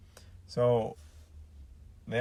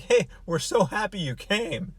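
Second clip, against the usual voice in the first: higher, louder welcome from mock penguins; happy penguins; 6 semitones higher, 5 dB louder than usual. Penguins greet visitors, mock cheerful. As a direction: greet them as a delighted penguin. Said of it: "the penguins aren't all like".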